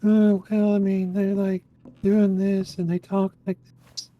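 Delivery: high, slow, mock weepy whine. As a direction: imitate an out-of-breath, anemic weakling, pitched high. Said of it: "got the weepy ass voice".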